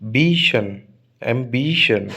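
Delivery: बीशन and ambition with a long "bee"; longer stressed syllable. Shown as a demonstration. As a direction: draw out the stressed "बी" in बीशन, ambition, then drop on "शन". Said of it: "higher, longer". (बीशन, ambition में "बी" लंबा करके)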